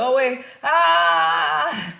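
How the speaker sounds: loud, high, drawn-out labor cry; re-enacted pain scream. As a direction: let pain break into a long scream. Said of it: "like, very painful".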